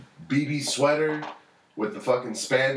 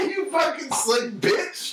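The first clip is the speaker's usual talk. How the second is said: mock outrage; 9 semitones higher than usual.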